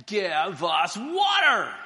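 slow, loud shouted demand. Acted. Thirsty people shouting at Moses for water, slow loud demand.

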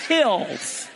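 "Hills." said alone with a short i as in sit; clear hills. Shown as a demonstration. Teacher stressing the right word, "Hills." with a short i. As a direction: land "Hills." firmly on the short i. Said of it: "Hills, not heels".

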